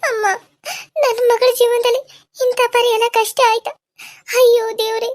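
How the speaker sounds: high crying lament; wailing. Acted. Mother wails over her daughter's suffering, crying. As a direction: sob out the grief for her daughter.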